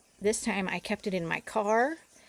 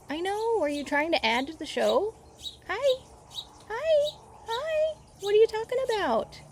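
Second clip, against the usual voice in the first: much higher, sing-song voice to the birds; talking to birds; 14 semitones higher than usual. Real, not acted. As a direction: coo at the birds like they're babies.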